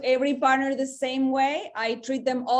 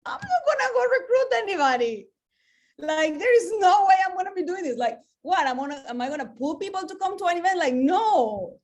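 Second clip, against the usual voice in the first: much higher, louder, flat-out refusing; defiant refusal; 7 semitones higher, 4 dB louder than usual. Retold or imitated. Retelling to the audience her old refusal to quit, high and loud. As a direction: throw up the whole idea, high and loud. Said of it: "because I was like".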